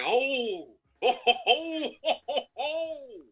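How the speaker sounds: high, laughing oh-oh-oh; laughing sideline cheer. Retold or imitated. Player imitating teammates on the sideline, high and laughing. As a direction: whoop and laugh like the whole sideline. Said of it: "we like".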